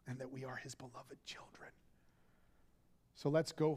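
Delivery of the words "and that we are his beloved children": hushed whisper, tender and close. Real, not acted. Preacher whispers to the congregation they are beloved. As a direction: let it fall to a whisper. Sermon, tender closing line.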